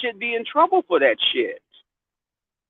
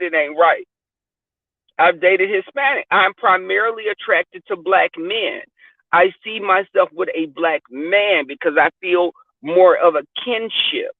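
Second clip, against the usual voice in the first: ordinary talk, nothing marked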